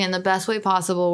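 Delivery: ordinary delivery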